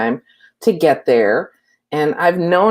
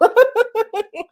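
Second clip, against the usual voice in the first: a bright, high burst of laughter. Real, not acted. Laughing at herself after the story: high laugh. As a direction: giggle at her own story.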